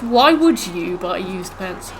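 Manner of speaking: drawn-out words at an everyday pitch; slow, pointed question. Acted. Challenging someone over buying used pants, slow pointed repeat. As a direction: stretch the question out slowly, word by word.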